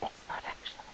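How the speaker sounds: whispered aside so the pet won't hear. Real, not acted. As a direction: whisper it behind his back. Secret aside kept from pet.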